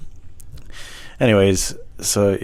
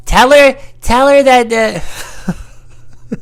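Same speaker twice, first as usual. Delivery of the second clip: high, loud squawk; loud, silly high-pitched voice; put-on character voice; 8 dB louder, much slower than usual. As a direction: squawk it out far above your own pitch. Acted quote in put-on voice.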